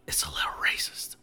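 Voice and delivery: quick, hushed whisper; whispered aside. Real, not acted. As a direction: slip it in under your breath, whispering. Sheepish aside of criticism.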